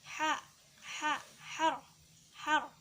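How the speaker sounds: "Ha" as a thin, airy Arabic h; ha tipis. Shown as a demonstration. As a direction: keep "ha" and "har" thin and short. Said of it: "ha harus dibaca tipis". ("ha" dibaca tipis)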